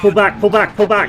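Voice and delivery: panicked shout, high and loud; frantic retreat order. Real, not acted. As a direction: yell it in real alarm. Tank spotted, panicked crew order.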